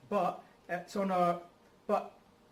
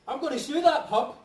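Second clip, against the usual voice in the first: laughing; 7 semitones higher, 8 dB louder than usual. Acted. Laughing through stomach pain.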